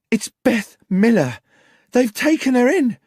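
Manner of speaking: high, loud, breathless alarm; frantic. Acted. Sarah tells the landlord the terrible news, frantic. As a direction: blurt the bad news in a panic. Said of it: "came screaming into the bar".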